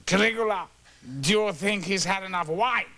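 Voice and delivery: a hint of a pompous drunk emperor; imperial O'Toole. O'Toole asking Caligula about the sentry, lightly grand.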